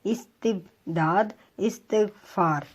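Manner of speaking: استبداد and استغفار said very slowly; slow demo words. Shown as a demonstration. Teacher reading example words slowly for students. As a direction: spell out each word slowly. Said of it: "اس طرح دیکھیں".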